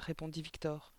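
plain reading voice

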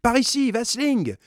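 high, loud call to a shipmate; high-pitched hail across the ice; shouting. A narrator's reading. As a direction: shout him over urgently. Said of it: "cria Jean Cornbutte au second".

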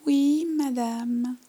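high, slow, careful polite answer; polite little girl. Acted. Girl answers the old lady, slow and polite. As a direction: answer like a well-behaved child. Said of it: "ai-je répondu poliment".

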